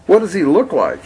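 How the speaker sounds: put-on child voice. Billy asks curiously.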